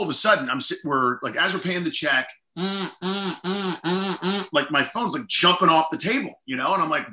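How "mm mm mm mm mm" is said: hummed bursts like a vibrating phone; phone-buzz imitation. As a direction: buzz in short, higher hums.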